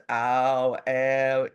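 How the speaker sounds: loud drawn-out "ow" sounds; vowel drill. Shown as a demonstration. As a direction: belt out "ow" like a voice exercise. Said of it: "you practice different sounds".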